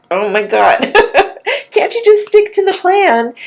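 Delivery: high-pitched exasperation, half laughing; exasperated parent. Retold or imitated. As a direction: retell a stressed-out plea through a laugh. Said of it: "I was getting so stressed out".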